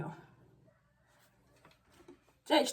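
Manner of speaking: ordinary talk, nothing marked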